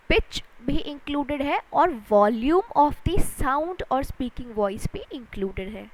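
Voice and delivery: usual speaking voice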